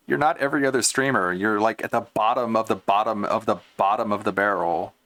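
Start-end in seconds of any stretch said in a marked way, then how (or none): none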